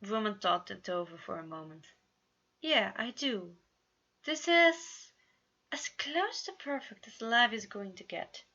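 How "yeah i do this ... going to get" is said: higher, content and dreamy; contented sigh. A narrator's reading. Roman answers Virgil, lightly contented and higher. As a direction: breathe it out happily. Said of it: "he sighed contentedly".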